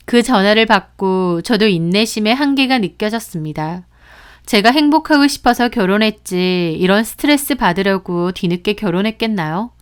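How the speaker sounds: ordinary delivery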